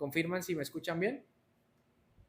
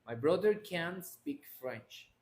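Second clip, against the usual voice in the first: slow model sentence; much slower than usual. Shown as a demonstration. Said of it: "Ahí estamos hablando".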